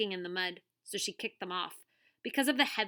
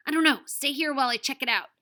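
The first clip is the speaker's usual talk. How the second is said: louder, fast and tense; urgent; 5 semitones higher, 8 dB louder, faster than usual. A narrator's reading. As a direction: rush it, keeping her back.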